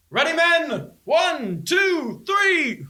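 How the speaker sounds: high, loud rallying call; shouted lifting count. Acted. Guard rallying men to lift a hulking body, high shout. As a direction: holler a drawn count to a crew about to heave.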